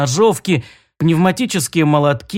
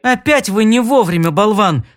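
sharp furious scolding, louder; angry; 6 semitones higher, 3 dB louder than usual. Acted. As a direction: snap at the fool, raised voice. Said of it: "разгневалась Шейла".

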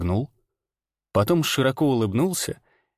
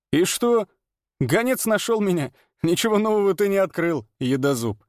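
dismissive showman; 7 semitones higher than usual. Acted. Ranner voiced, dismissive.

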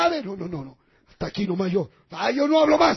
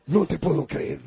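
ordinary talk, nothing marked

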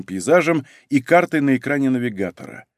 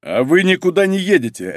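higher, forceful veto; sharp, raised-pitch order; abrupt objection; 6 semitones higher, 4 dB louder than usual. A narrator's reading. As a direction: cut in and forbid it. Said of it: "резко встал Малинин".

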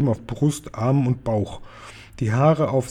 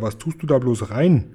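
ordinary delivery, nothing marked

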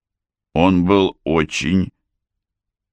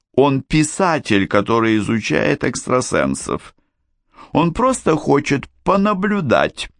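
high character voice; 12 semitones higher than usual. A narrator's reading. Mora explains the visitor.

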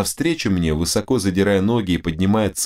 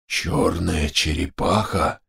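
slow, choked exclamation; strangled, astonished; 2 semitones lower, slower than usual. A narrator's reading. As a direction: force it out slowly, stunned. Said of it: "сдавленно произнёс Нгала". (сдавленно, медленно, в изумлении)

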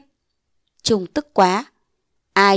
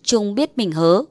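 usual speaking voice

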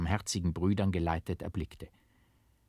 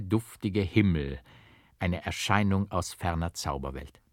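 plain reading voice

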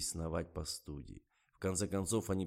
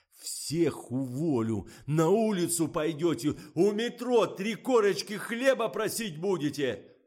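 loud threats climbing higher; raging tirade; 10 semitones higher, 9 dB louder, slower than usual. A narrator's reading. Director threatens to fire everyone, furious rant. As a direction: rant at full voice, rising to a screech. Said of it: "пошёл в разнос Фомин".

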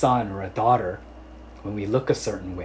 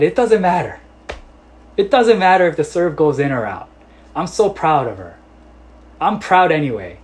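proud insistence; 6 semitones higher, 8 dB louder than usual. Retold or imitated. Proud father answers coach.

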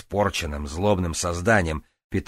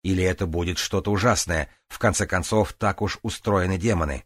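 ordinary delivery

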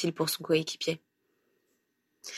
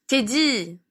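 higher, indignant exclamation; wrathful; 5 semitones higher than usual. A narrator's reading. Grandmother calls after runaway grandson, angrily. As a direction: scold a runaway child sharply. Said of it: "s'exclama une voix courroucée".

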